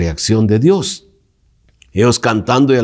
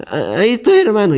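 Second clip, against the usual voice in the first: mock glum sigh of a sour churchgoer; sulky complaint; 12 semitones higher, faster than usual. Preacher mimics a sulking brother, mock gloomy. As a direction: pull a long face and moan it, pitched higher. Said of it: "haciendo cara mala".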